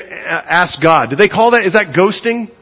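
normal talking voice

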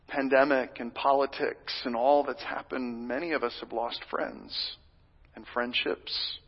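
ordinary talk, nothing marked

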